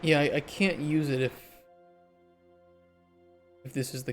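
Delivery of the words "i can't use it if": normal talking voice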